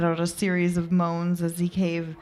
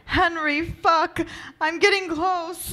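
very high, urgent cry; high, overcome exclamation; breathless urgency; 12 semitones higher, 4 dB louder than usual. Acted. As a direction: cry out, pitch soaring. Acted passion, rising urgency.

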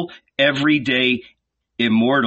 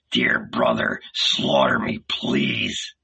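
much lower mocking voice; mocking whine; 12 semitones lower, slower than usual. A narrator's reading. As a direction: sneer as a meek victim. Mocking quote of meek victim.

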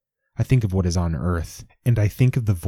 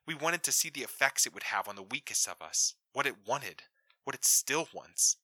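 hushed, rushed woman's voice, much softer; breathless quick woman; 4 semitones higher, 12 dB quieter than usual. A narrator's reading. Woman on the comm warning the astronaut, quick and hushed. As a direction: she hurries her secret out under her breath. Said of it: "Her words come quick and breathless and sibilant".

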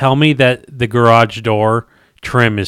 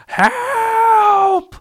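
high, long cry of help; playful high help call; mock scream for help. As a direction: wail it out like you're stranded. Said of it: "And I'm screaming like".

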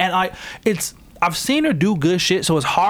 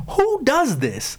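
squeaky, much higher exasperated question; mock-yelling at characters on screen, voice much higher; high mock outrage; 11 semitones higher, slower than usual. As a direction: pitch way up in disbelief at the screen.

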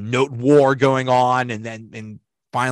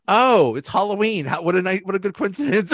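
feigned delight; 6 semitones higher than usual. Retold or imitated. Blood-splattered character feigns delight.

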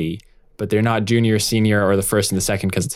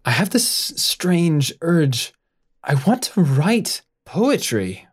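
high, slow, far-off musing; lightly voiced dazed doctor; dreamy Horsley; 7 semitones higher, slower than usual. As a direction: drift off as if entranced. Horsley dazed, odd urge.

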